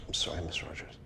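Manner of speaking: hushed, ashamed whisper; whispered apology. Acted. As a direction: barely breathe the apology. Caught lying, quiet apology.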